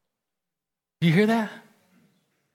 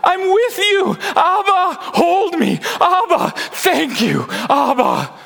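very high shouted cry, easing at the end; joyful new cry; 4 dB louder, slower than usual. Acted. Believer at the finish line seeing Abba, ecstatic cry. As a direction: cry out high as if finally seeing your father. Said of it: "cry out with a new cry".

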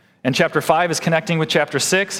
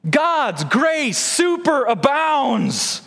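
slow, high, stretched out; emphatic preaching; 10 semitones higher, much slower than usual. Real, not acted. Pastor proclaiming grace, slow and emphatic. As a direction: draw out superabounds with weight.

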